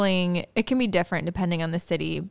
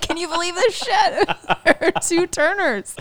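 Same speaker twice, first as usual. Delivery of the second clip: mock exasperated staff; 10 semitones higher, 3 dB louder than usual. Mocking the confused hotel staff.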